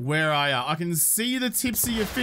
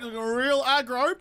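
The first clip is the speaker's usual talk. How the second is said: ordinary delivery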